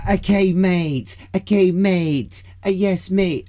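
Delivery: put-on loud, high cliched British accent; mock British accent. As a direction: overdo a silly British accent, slow and high. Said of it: "no French person's going".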